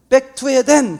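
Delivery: loud, high call to halt; retold firm command. Calling the son away from food, loud firm command. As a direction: call it out like a practised stop command.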